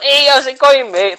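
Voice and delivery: high, loud, overeager hello to a TV presenter; eager greeting. Retold or imitated. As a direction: greet him like a starstruck young fan. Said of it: "a bit enthusiastic".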